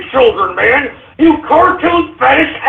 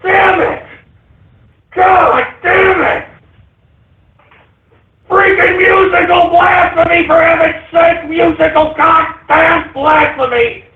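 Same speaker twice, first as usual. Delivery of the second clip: furious shouting; 4 semitones higher than usual. Real, not acted. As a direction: scream in disgust. Host raging at song mashup.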